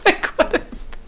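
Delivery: high laughing disbelief. Retold or imitated. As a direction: squeal it through a laugh. Laughing mock bafflement.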